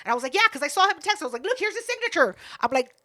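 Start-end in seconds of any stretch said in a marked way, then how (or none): 0.3-1.2 insistent retort. Retold or imitated. Retold insisting to merch seller. 1.4-2.3 high-pitched eager insistence; insistent proof. Retold or imitated. Fan showing the signed jersey as proof, high and insistent.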